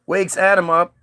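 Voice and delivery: normal talking voice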